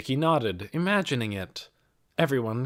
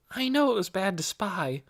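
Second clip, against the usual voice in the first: a hint of a girl's higher voice; lightly voiced young girl; 9 semitones higher than usual. A narrator's reading. Nicky answers Mrs. Beeson, lightly voiced as a girl. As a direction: lightly voice Nicky, eager and polite.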